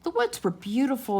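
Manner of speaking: plain reading voice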